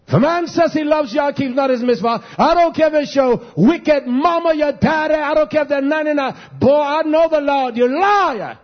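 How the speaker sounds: high, forceful pulpit denunciation; fiery rebuke to the congregation; impassioned preaching. Real, not acted.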